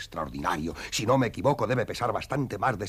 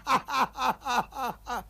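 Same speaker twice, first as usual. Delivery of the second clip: high, drawn-out laugh; amused full laugh; hearty laugh. Acted. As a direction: let the laugh roll out. Keeps laughing after joke.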